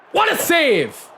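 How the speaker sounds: high, loud boast; excited shout. Real, not acted. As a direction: crow with delight. Celebrating a save.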